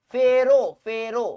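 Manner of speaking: ফেরো, ফেরো drawn out slowly and firmly; slow drill. Shown as a demonstration. Teacher drilling the rule ফেরো for K4, slow chant. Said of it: "K four হইলে".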